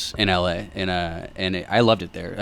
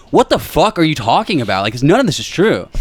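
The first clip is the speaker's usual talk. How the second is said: retold outburst, higher and louder; incredulous protest; 6 semitones higher, 4 dB louder than usual. Employee answering bosses who call him rude, incredulous. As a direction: challenge the accusation, pitched up.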